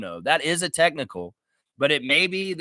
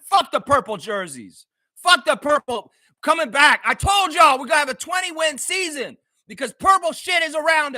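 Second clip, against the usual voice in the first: higher, louder furious yell at the jerseys; angry shouting; 9 semitones higher, 4 dB louder than usual. Real, not acted. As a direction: rage at the purple jerseys. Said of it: "This is pissing me off".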